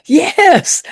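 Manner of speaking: very high, thrilled yes; excited fan confirming. Retold or imitated. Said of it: "he was really excited".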